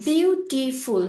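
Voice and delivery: Beautiful in three beats, বিউ, টি, ফুল; teacher sounding out BIU - TI - FUL; split into three syllables. Shown as a demonstration. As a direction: separate each part of beautiful. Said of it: "তিনটা পার্টে divide করছি".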